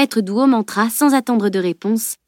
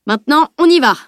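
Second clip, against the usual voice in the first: a loud, higher command; ordering; 6 semitones higher, 6 dB louder than usual. A narrator's reading. Tutor ordering the three boys to leave, loud. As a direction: bark the order to move.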